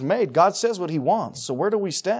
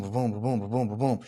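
imitated heartbeat; 4 semitones lower than usual. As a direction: mimic a racing heart under your breath. Heart pounding at the temptation.

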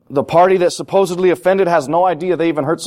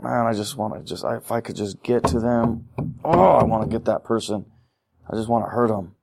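quiet seething; 4 semitones lower, 6 dB quieter than usual. Retold or imitated. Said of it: "you're just like".

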